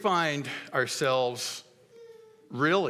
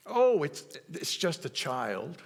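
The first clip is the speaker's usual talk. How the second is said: dismissive parent; 4 semitones higher, slower than usual. Retold or imitated. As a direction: brush it off as no big deal.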